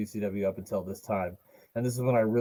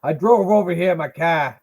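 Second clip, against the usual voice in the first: higher, louder mock Boston accent; badly faked Boston, r dropped in "car"; 7 semitones higher, 7 dB louder than usual. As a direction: lay on a hammy Boston accent. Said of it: "I can't do a Boston accent besides car".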